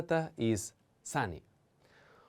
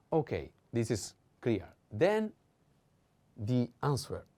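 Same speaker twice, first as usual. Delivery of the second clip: normal talking voice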